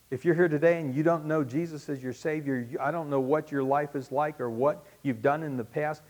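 ordinary talk

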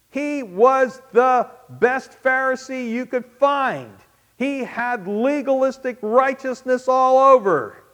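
loud, high, emphatic preaching; fervent sermon climax; preaching at full force. Acted. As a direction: drive the point home from the pulpit. Preacher building to a peak.

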